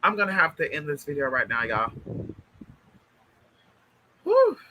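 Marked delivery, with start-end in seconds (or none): 4.2-4.6 emotional whew. Real, not acted. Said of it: "I don't wanna be emotional".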